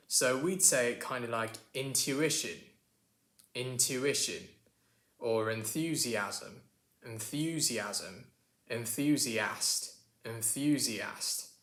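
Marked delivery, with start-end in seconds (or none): 1.7-11.5 British demo with a y-glide in intuition and enthusiast; slow, careful British cousin saying 'enthusiast'; British accent. Acted. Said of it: "my British cousin here".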